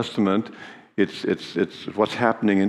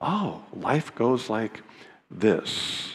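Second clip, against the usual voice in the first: soft, slow dawning realization; quiet realization; 6 dB quieter, much slower than usual. Retold or imitated. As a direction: let it sink in quietly, slowly. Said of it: "we really accomplish that and go".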